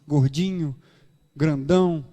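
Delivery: ordinary delivery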